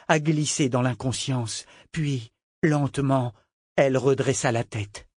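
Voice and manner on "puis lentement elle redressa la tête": ordinary delivery, nothing marked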